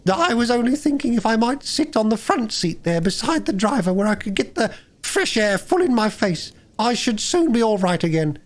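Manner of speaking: high, wheedling lady voice; sweetly angle for the front seat; washerwoman voice. A narrator's reading. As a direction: Toad in disguise asking for the front seat. Toad as washerwoman.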